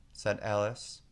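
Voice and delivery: plain reading voice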